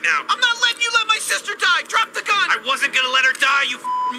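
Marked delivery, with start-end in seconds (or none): 0.3-1.9 high, frantic shout; desperate yell. Acted. Protecting his sister, yelling desperately. 1.9-2.5 high yelled "Drop" falling hard; angry shout. Acted. Repeating the order to Rick, shouting.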